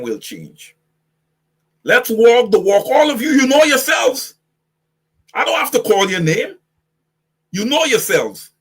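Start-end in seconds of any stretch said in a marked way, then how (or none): 1.8-2.8 loud challenge. Shown as a demonstration. 2.9-6.6 loud and high, calling them out; shouting at them. Real, not acted. Preacher shouting at supporters who know they are guilty.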